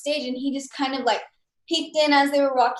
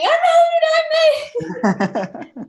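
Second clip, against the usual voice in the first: much higher, much louder, slow laughing cry; excited shout; 9 dB louder, slower than usual. Retold or imitated. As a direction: scream it out like you just won. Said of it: "do another take of running up saying".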